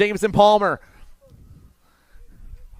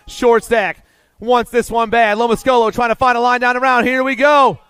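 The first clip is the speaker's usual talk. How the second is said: rising, louder finish call; excited race call; 4 semitones higher, 3 dB louder than usual. Real, not acted. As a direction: build to the finish line.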